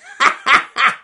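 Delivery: shrill sneaky laugh; high villain cackle. Acted. Weasel laughing.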